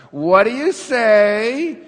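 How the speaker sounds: a hint of a mom pressing firmly; insistent mom prompt. Mom again pressing her child to give thanks at a party. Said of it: "she's like".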